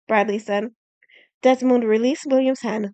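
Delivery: plain reading voice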